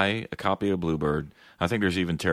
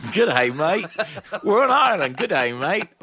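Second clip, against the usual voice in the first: high, loud, laughing fake Australian accent; bad Australian accent; 12 semitones higher, 6 dB louder than usual. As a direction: ham up an Australian accent, cracking up. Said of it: "We don't know how accents work".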